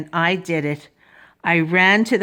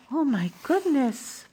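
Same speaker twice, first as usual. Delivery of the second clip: soft, higher-pitched gasp of wonder; hushed amazement; 4 semitones higher, 7 dB quieter than usual. Acted. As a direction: gasp it softly in suspense. Hushed aside as everyone watches.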